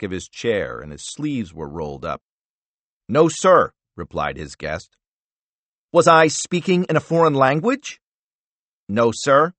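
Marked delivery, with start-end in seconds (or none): 3.1-3.7 tense reply. Acted. CIA director answering senator. 5.9-8.0 loud, high, mocking question; condescending anger. Senator mocking his guest. 8.9-9.5 tight, frustrated reply. Acted. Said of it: "repeated in a frustrated tone".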